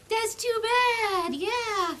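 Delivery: mock dismissive. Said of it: "And I'm like".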